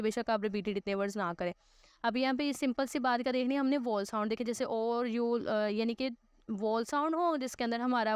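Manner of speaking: ordinary talk, nothing marked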